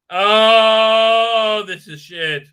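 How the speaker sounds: ordinary delivery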